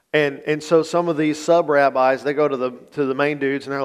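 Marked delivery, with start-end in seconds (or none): none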